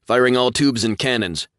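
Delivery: crisp, loud combat callout; loud announcement. A narrator's reading. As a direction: announce it firmly. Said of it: "Vidmar announced".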